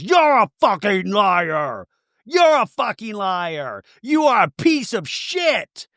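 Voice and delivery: slow, hammed-up yelled accusation; mock shouted insult. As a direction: spit it out slowly like a reenacted fight. Tamara screaming at Gretchen, retold.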